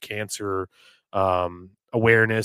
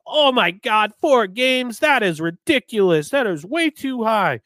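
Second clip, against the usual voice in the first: outraged fans; 10 semitones higher, 5 dB louder than usual. Retold or imitated. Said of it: "Everybody's like".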